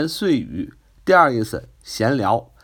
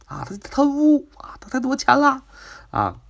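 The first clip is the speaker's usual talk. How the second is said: higher, hammed-up gossip tattling; a mock gossiping neighbour, rising higher; 10 semitones higher than usual. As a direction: gossip about a celebrity behind her back, higher. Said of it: "很多人说".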